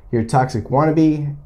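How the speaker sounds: ordinary delivery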